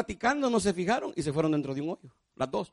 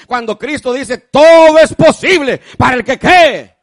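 loud, high fervent preaching; a sermon shouted from the pulpit; preaching at full force; 8 semitones higher, 17 dB louder than usual. Acted. Preaching climax to congregation.

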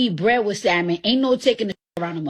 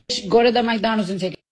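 ordinary talk, nothing marked

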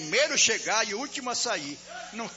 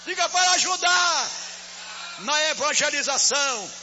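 shouted rebuke; 9 semitones higher, 9 dB louder than usual. Real, not acted. Pastor leads the church rebuking misery, full shouting. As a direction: roar it out, casting misery from the house.

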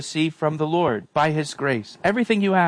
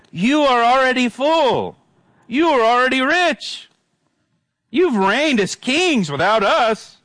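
high-pitched, drawn-out mock praise; sarcastic; 9 semitones higher than usual. Paul needling the boastful Corinthians, sarcastic. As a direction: proclaim it grandly with mock admiration, pitch up high. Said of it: "he uses sarcasm here".

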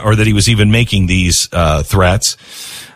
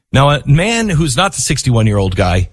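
ordinary talk, nothing marked

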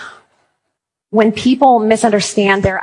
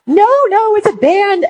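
put-on much higher, slow, whiny teen girl voice; imitating sobbing teen girls; 12 semitones higher than usual. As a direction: play the heartbroken fourteen-year-old fan, high and drawn out. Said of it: "What's the issue?".

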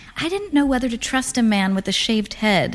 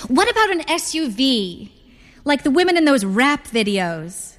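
higher, playful mother's voice; showy mother; 5 semitones higher than usual. A narrator's reading. Mother turning to the car salesman, showy. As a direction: pitch it up and show off for the salesman.

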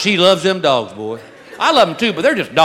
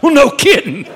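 sarcastic retort; 6 semitones higher than usual. Retold or imitated.

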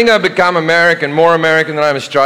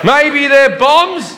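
very high-pitched, exaggerated comeback; flustered mock reply; 10 semitones higher, much slower than usual. Traveller answers security guy about shoes, high flustered reply. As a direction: squeal it like a clueless traveller, hammed-up.

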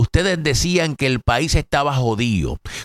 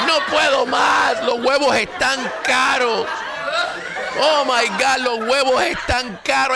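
mocking panicked voter; 11 semitones higher than usual. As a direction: whine it up high like a hysterical complainer. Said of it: "estaban gritando desesperado".

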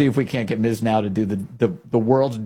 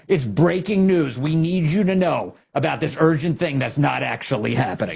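high, urgent parody of an anchor; mock breaking news; 4 semitones higher than usual. Host parodying the channel's urgent story, mock breaking news. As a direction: sell it as hugely urgent, mockingly. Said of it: "breaking news story on the air".

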